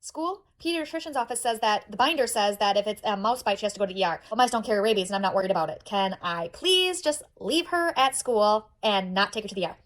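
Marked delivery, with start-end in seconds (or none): 5.8-9.8 very slow, spaced-out plea; weary pleading. Acted. Mom to school about skipping the E R, weary pleading. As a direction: beg word by word, patience running out.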